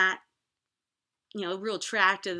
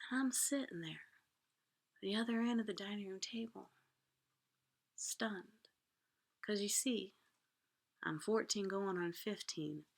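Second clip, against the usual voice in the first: near-whispered narration, much softer; hushed, stunned; 8 dB quieter than usual. Real, not acted. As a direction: let the words drop to a shocked hush. Stunned child overhearing the truth.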